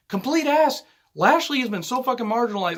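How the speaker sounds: high-pitched, indignant rant; exasperated. Real, not acted. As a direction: vent with your voice pitched right up. Exasperated rant about wrestler's booking.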